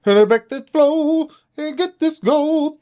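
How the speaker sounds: high, slow put-on rap voice. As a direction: stretch the line out high like the rapper. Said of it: "K J B or whatever".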